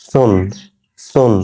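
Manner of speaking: "সুন" with tongue tip touching the upper teeth; lisped th at the start of "সুন"; tongue-tip th sound. Shown as a demonstration. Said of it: "জিহ্বার আগা ওপরের দাঁতের আগার সঙ্গে লাগিয়ে". (জিভের আগা ওপরের দাঁতে লাগিয়ে "সুন")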